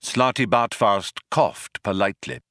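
usual speaking voice

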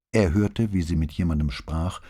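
ordinary delivery

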